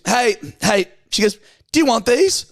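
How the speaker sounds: high bright call from the car; imitating Steph. Steph calls to Jack from the car, high mock call. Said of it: "She looks up, she goes".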